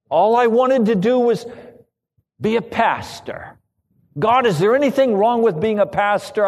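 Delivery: high, loud, accusing wail at God; railing at God. Retold or imitated. Pastor railing at God in his despair, loud and high. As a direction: rail at heaven like a wronged victim. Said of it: "I railed at the Lord".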